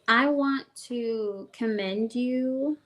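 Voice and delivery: ordinary talk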